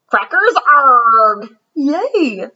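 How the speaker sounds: loud, slow hammed-up pirate growl. Greedy pirate in Brian's drawing finding treasure, hammed-up pirate.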